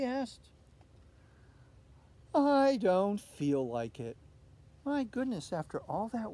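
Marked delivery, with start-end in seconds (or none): 2.3-4.2 put-on sulky girl. A narrator's reading. Olivia sulks.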